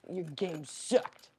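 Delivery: slow, lightly voiced rabbit grumble; teasing the bunny. To bunny Casper, slow teasing grumble. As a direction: drag it out, teasing.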